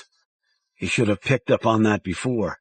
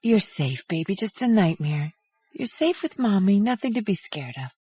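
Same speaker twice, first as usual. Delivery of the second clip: soothing mother; 9 semitones higher, 4 dB quieter than usual. A narrator's reading. Mother soothing her child.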